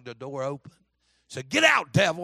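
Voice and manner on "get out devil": louder imitated order; commanding shout. Fella orders devil from his cart, yelling. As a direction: throw the devil out with a sharp yell.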